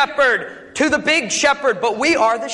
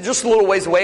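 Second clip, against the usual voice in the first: ordinary delivery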